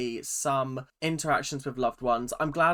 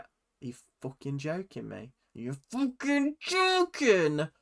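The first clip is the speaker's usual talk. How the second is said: shocked disbelief; 4 semitones higher than usual. Retold or imitated. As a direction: voice leaps much higher in mock outrage. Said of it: "everyone was just like".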